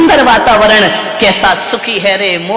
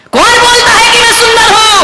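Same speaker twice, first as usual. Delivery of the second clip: protesting swan; 11 semitones higher, faster than usual. Acted. Swan denying to the crow that it is beautiful, high indignant protest. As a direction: cry out in disbelief as the swan.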